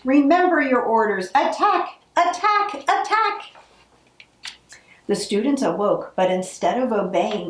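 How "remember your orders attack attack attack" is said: high rising screech of attack; shrieked command. Acted. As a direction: whip the troops into a charge. Witch urging her troops.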